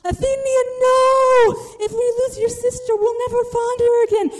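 high, panicked imitation of a yell. Chris yelling after sibling going the other way, panicked. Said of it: "he yells out".

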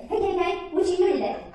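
high-pitched, chirpy phone greeting; cheery mother's high voice; imitated cheery mom. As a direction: chirp it like mom calling early. Mom's cheery early call, retold.

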